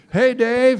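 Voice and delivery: high, loud hey across the street; calling out. Retold or imitated. Greeting Dave as he drives up, called out loud and high. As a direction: shout a greeting to a car pulling up.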